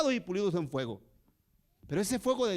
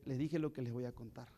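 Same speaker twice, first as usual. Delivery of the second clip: normal talking voice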